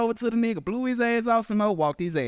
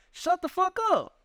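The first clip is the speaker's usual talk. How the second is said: mock shut up; 10 semitones higher than usual. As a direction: act like you're done listening to him. Acting out telling someone off.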